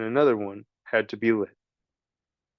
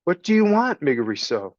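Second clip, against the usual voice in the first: higher and louder call; shouted question; 5 semitones higher, 4 dB louder than usual. Acted. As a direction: call it out to be heard. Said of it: "Princess shouted".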